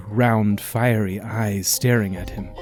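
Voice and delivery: ordinary delivery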